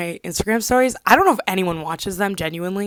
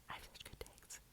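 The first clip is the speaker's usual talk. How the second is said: very soft whispered repeat; 33 dB quieter than usual. Real, not acted. Whispering her own brag back to herself. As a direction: whisper it to yourself.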